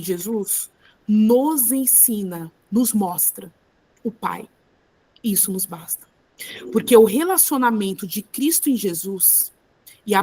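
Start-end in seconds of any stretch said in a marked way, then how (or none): 0.0-6.1 measured, heartfelt request; disciple's true plea. Acted. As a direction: weigh each phrase, softening toward the end. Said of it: "Ele fala assim".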